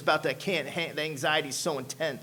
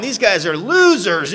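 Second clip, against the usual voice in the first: louder, slow sneer; scornful judging; 4 semitones higher, 10 dB louder, slower than usual. Retold or imitated. Young addict sizing up AA men, slow scornful. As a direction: draw it out with contempt.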